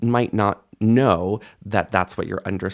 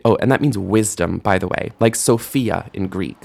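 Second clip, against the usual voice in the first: ordinary delivery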